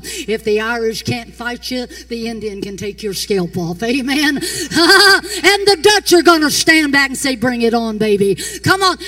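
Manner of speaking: loud, rising preacher's shout; preaching at full force. Acted. Preacher boasting of her Irish and Indian blood, shouting. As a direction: roar it out with a grin.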